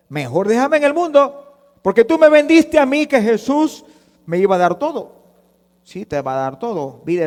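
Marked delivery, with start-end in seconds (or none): 0.1-5.2 high, raised acted protest; indignant complaint. New believer blames whoever sold him Jesus, indignant. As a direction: complain loudly as the let-down convert. Voices disillusioned convert complaining.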